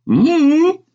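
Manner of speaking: high, loud hum through food; muffled child's reply with stuffed cheeks; mouth full. A narrator's reading. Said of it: "replied with my mouth completely full".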